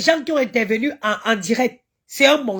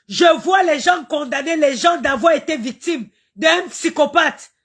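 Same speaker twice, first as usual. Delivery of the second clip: ordinary talk, nothing marked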